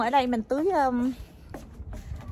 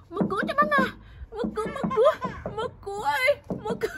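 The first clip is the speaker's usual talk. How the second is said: high coaxing; 10 semitones higher, faster than usual. Real, not acted. Mother calls crying child to open the door, high coaxing. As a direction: call to a little one, pitched way up.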